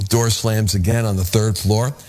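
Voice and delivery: ordinary delivery, nothing marked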